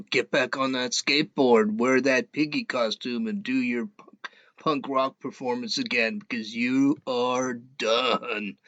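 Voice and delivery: low, soft, scornful brush-off; scornful dismissal. Real, not acted. Mocking a candidate he thinks is finished, low and scornful. Mocking Beto after his debate answer.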